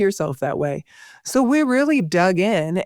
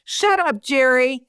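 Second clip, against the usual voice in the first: playful yell; 7 semitones higher, slower than usual. Retold or imitated. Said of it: "I'll yell".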